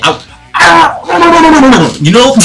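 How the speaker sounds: high-pitched laughing scream; mock feral scream while biting; wild mock shriek. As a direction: shriek and cackle like a feral kid. Biting arm, wild yell.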